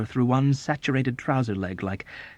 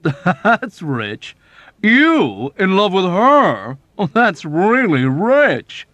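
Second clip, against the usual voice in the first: higher, louder, slow mocking voice; the smug officer scoffing, drawn out; mocking Arfie; 9 semitones higher, 9 dB louder, much slower than usual. Arfie mocking Nately's love.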